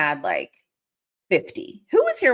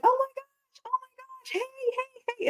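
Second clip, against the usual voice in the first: high squealing hellos, fading softer; excited greeting; 7 dB quieter than usual. Retold or imitated. Greeting football friends back for the season, high excited squeal. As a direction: gush over old friends in a high squeal. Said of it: "And I'm like".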